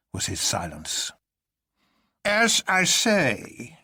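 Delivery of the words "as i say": much higher, very slow and labored professor voice; professor toiling through sand. A narrator's reading. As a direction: drag each word out like walking through sand. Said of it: "like a man toiling through heavy sand".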